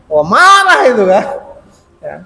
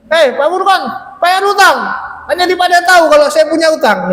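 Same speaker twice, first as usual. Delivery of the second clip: mock angry demand; 7 semitones higher than usual. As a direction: act out the rude creditor. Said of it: "ibaratnya saya lagi ngisi".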